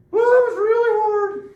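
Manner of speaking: very high, loud squeaky voice, near a whine. Retold or imitated. Teacher mocking the gold medalist's interview in a squeaky voice. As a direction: mimic a tiny squeaky voice that doesn't fit the big body. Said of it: "and he was like".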